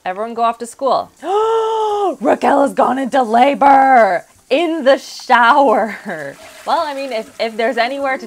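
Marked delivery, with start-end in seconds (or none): 1.1-2.1 a sudden high surprised gasp; sharp gasp. Real, not acted. Sims woman goes into labor. 2.2-6.4 loud, slow, shocked announcement; shocked shout. Real, not acted. Shocked at sudden labor news.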